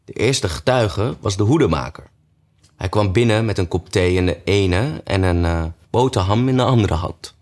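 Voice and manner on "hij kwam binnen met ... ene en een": usual speaking voice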